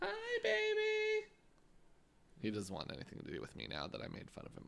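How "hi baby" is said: very high, drawn-out coo; slow squeaky greeting to a pet; baby talk. Pretend. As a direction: coo sweetly at a dog.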